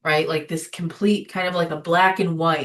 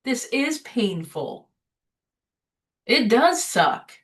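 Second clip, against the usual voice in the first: slow, soothing words to herself; slow and tender, comforting herself; gentle self-talk; 4 semitones higher, much slower than usual. Retold or imitated. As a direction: give yourself grace, slowly. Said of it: "trying to say".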